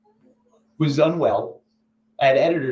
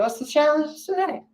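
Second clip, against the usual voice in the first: asking; 13 semitones higher than usual. Retold or imitated. His question, voiced.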